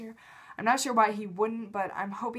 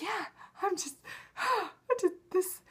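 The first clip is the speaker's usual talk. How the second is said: high, softer, gasping mock crying; imitated bawling; 10 semitones higher, 4 dB quieter than usual. Fan re-enacting her answer to Johnny, imitated bawling. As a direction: squeak it out between pretend sobs. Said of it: "bawling my eyes out".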